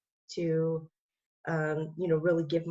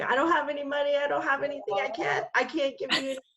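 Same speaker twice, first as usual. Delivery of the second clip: higher, panicked plea through tears; retold crying plea; 9 semitones higher, faster than usual. To the mechanic, she pleads higher through tears. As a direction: beg a stranger, voice pitched up in distress. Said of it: "You know, I'm crying".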